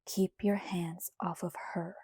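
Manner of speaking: cold, menacing whisper; dark whisper. A narrator's reading. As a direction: whisper it as a threat. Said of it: "Edward answered blackly".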